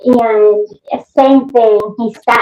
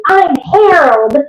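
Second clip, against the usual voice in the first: high, slow imitated declaration; possessed man's answer; 6 semitones higher, slower than usual. Spirit naming himself to the investigator, declaring. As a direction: announce yourself slowly, pitched up. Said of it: "And he goes".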